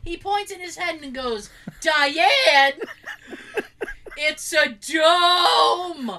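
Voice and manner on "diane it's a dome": loud, hammed-up exclamation with a long-drawn DOME; booming mock announcement, last word held; acting out the outburst. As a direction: imitate an exasperated man, stretching the last word out. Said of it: "the audio people the full th-, experience".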